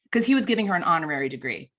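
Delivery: ordinary delivery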